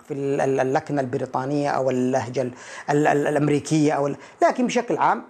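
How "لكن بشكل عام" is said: ordinary talk, nothing marked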